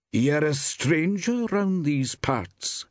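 high, slow, cold woman's voice. A narrator's reading. Woman shopkeeper sizes up a stranger, coldly. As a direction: observe it coldly, drawing it out. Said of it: "she observed coldly".

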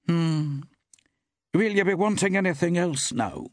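high-pitched, cold woman's voice. A narrator's reading. Woman shopkeeper regrets chatting with a customer, coldly. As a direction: close the chat off coldly. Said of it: "Her voice was cold".

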